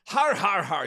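loud, high booming har har; bombastic laugh. Acted. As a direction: boom it like a big Scottish guy. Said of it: "laughing like this".

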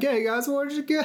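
high, dopey shark voice; goofy Jaws voice. Retold or imitated. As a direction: play the shark as a clueless goof. Jaws voiced in movie recap.

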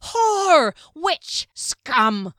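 high, loud jeering insults; angry crowd shouts. A narrator's reading. Frenzied crowd yelling at the accused girl, loud angry jeers. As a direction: hurl each word like a stone.

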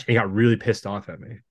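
ordinary talk

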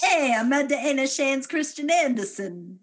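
high, loud, bad Danish accent; badly faked Danish accent with a laugh; mock Danish accent. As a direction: ham up a Danish accent nobody could place. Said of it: "What is that accent?".

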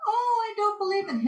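high-pitched mock disbelief; imitated amazement. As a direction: play the astonished bus driver. Retold bus driver amazed at sky.

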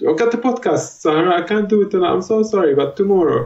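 high, pleading sorry voice; apologetic dad. Retold or imitated. Dad apologizing to his daughter Sarah, high and pleading. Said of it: "I'm like".

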